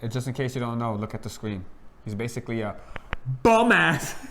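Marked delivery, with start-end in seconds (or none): 3.4-4.2 loud punchline. Pretend. Punchline of skit.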